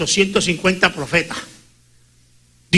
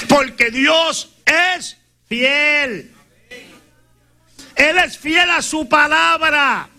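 raised, slow, drawn-out proclamation; fervent pulpit proclaiming, higher and slow; preaching at full force; 6 semitones higher, much slower than usual. Acted. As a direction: lift each line high and hold it like a pulpit cry. Preacher proclaims God's faithfulness.

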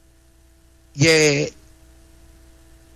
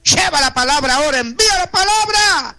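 much higher, impassioned cry to God; fervent cry; 8 semitones higher than usual. Acted. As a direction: lift the plea up into a ringing cry. Fervent prayer climax. (clamor ferviente y agudo)

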